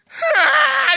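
high, loud mock toddler wail; imitated wail. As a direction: burst into a hammed-up child's cry. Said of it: "he just starts wailing on me".

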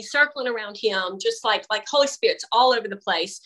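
usual speaking voice

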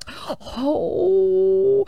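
high emotional oh; a choked-up little oh; touched gasp. Retold or imitated. Wife's touched reaction.

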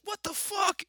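high, loud burst of disbelief; imitated outraged yell. Storyteller as his buddy whacked with a baton, outraged high yell.

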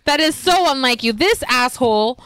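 ordinary talk, nothing marked

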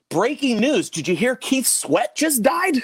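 high, loud, drawn-out bulletin; mock breaking news. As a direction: announce the hoax like shocking news. Re-enacts fake on-air news bulletin.

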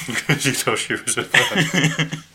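laughing through the words, slow. Real, not acted. Laughing while explaining.

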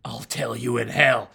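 tough-guy growl rising to a shout; defiant prisoner. Pretend. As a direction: spit the threat back at the guard. Acted defiant prisoner reply.

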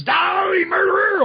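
high, drawn-out villain cry; Carrion. Retold or imitated. Carrion appearing to accuse Peter, mock villain cry. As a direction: shriek it like a comic-book villain. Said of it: "then Carrion comes and says".

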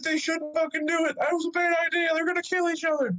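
high-pitched imitation of a worried onlooker; squeaky-high retold worry; high mock voice. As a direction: push it way up high, quoting him. Retold bystander's alarmed high remark.

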